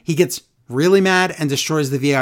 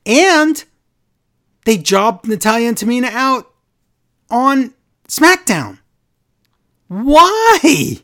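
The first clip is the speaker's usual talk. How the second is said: high, loud exasperated why; outraged disbelief; 8 semitones higher, 5 dB louder, slower than usual. Real, not acted. Wrestling fan ranting to cohost, outraged high and loud. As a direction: rant at the bookers in disbelief.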